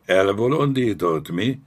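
irate shouted command; angry bellow. A narrator's reading. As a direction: roar orders at cowed soldiers. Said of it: "ordította megszeppent zsoldosokra".

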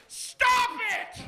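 high, strained shout; exasperated yell. Acted. Customer protesting extra potato wedges, exasperated yell. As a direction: scream it in protest.

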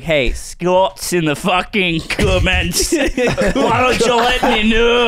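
put-on slow, drawn-out Scottish accent; mock Scottish accent. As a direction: appeal to Scots in a hammed-up accent. Addresses Scots in mock Scottish accent.

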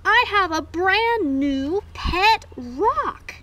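big, slow, loud reveal; thrilled drumroll-style reveal; excited. Acted. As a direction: announce it proudly, stretching the words high and loud. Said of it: "I am so excited".

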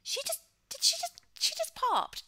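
high, loud shocked squeal. Real, not acted. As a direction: squeal in disbelief at what she just did. Shocked reaction to game character.

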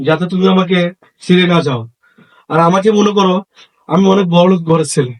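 low, halting admission of guilt; remorseful, pitched well down; ashamed confession. Acted. Son confessing shamefully about his father.

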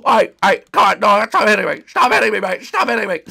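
high, loud repeated protests; flustered yelling. Acted. Fighter being hit by bunnies, flustered and loud. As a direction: shout them off urgently.

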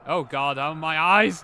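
loud exclamation rising in shock; pained outcry. Real, not acted. Player reacting to the game hurting the eyes, pained outcry. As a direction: cry out loudly as if dazzled. Startled by sudden glare in game.

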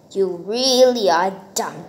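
normal talking voice

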